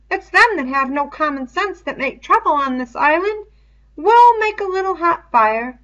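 put-on fussy, clever boy explaining eagerly; Piggy's voice. Piggy's dialogue in a reading.

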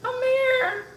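high, pleading whine; tearful plea. Real, not acted. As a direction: call him over for a crying hug. Tearful mother calls man over.

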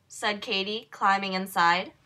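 ordinary delivery

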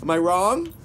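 ordinary delivery

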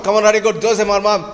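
ordinary talk, nothing marked

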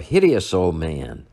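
ordinary talk

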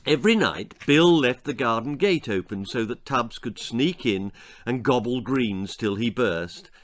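ordinary delivery, nothing marked

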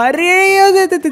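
sudden high shout at the screen; excited yell. Real, not acted. Gamer yelling at a flying car, excited shout. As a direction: yelp as the car flies. Car crash in racing game.

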